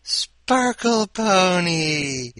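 high, loud, gushing squeal over a toy unicorn; giddy excitement. Pretend. Host reacting to the unicorn story, high loud giddy squeal. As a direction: squeal it like a delighted kid, stretching the words.

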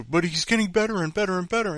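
high, fast mock gushing; mocking fan voice. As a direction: parrot a fan's praise with mockery. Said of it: "It was a".

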